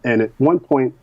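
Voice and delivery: ordinary talk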